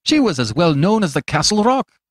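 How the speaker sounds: objecting. A narrator's reading. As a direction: press the objection, voice lifted. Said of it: "objected Fettes".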